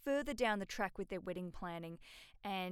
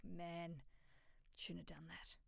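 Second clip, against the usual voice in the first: deflated, much softer voice; regretful; 3 semitones lower, 12 dB quieter, slower than usual. Acted. Bride acting out second thoughts after spending money, softly regretful. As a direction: sink into quiet regret, trailing away.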